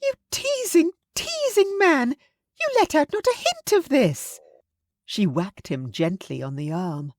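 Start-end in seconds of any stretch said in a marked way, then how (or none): 0.0-4.4 very high, squealing mock scolding; Missus Bennet, excited. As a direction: tease him in a very high, gushing squeal. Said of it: "Missus Bennet screamed".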